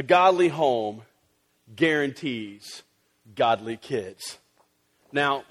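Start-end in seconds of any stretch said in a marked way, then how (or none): 1.7-4.4 slow and deliberate, lower; slow, weighty emphasis. Acted. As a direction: let each word land. Emphasis on legend.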